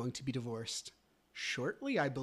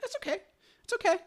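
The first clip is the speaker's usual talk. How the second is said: much higher pitch, lightly acted; coy retold reassurance; 6 dB louder, faster than usual. Man retells letting his lawyer off, coy high voice. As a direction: put on a high little it's-okay voice.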